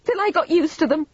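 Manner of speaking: loud accusation climbing in pitch; overwrought, rising resentment; bitter tirade. A narrator's reading. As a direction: build into a high, loud outburst. Vivian's bitter tirade at Claire.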